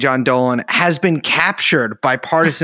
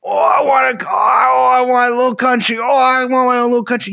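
high mock-crying whine; hammed-up sobbing plea, higher; imitating a whiny country, higher; mock whining; 8 semitones higher than usual. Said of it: "these people going crazy".

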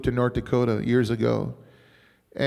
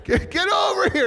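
high, raised-voice summons; imitated yelling. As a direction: holler them over, half in fun. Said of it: "I don't wanna yell at her".

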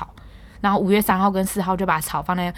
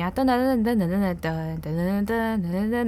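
imitated truck jingle; slower than usual. Mimics garbage truck jingle.

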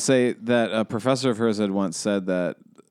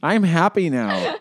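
higher, slow and sweetly insistent; mock cheerful wife; 7 semitones higher, much slower than usual. Husband mimicking his smiling wife the next morning, high and slow. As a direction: play the wife chirping that all is fine, drawn out and high.

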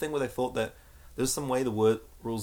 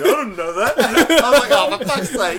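high, loud laughing mock dismay; cracking up through the words; laughing exasperation; 12 semitones higher, 13 dB louder than usual. Retelling his reaction to the rules, laughing.

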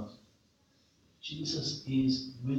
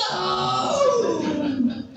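long, loud, high wail of no; hammed-up terrified yell, held out; panicked shout; 7 dB louder than usual. Terrified crewman as the boat speeds off, panicked shout. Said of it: "I'm there going".

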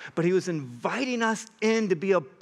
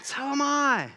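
defiant retort; 9 semitones higher than usual. Retold or imitated. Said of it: "Paul's like".

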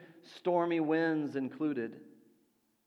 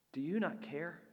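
quiet plea; 7 dB quieter than usual. Acted. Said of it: "the words we often address to God".

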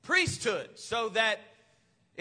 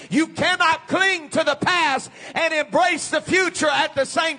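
loud, higher preacher's call, driving hard; full-force preaching; 5 semitones higher, 7 dB louder than usual. Real, not acted. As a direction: thunder it out to the congregation.

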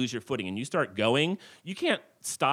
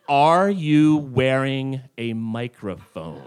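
much slower, louder, deliberate question; slow and pointed; 6 dB louder, much slower than usual. Retold or imitated. Martha to the guide, slow deliberate question. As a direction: spell it out word by word. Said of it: "she looks at me very slowly".

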